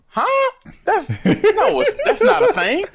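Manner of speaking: high, laughing "Huh?" of disbelief. Retold or imitated. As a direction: laugh it off as nonsense.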